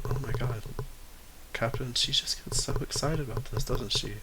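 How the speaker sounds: soft aside to the captain; Spring confiding softly to the captain; whisper. Acted. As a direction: lean in and murmur. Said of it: "she whispers to you".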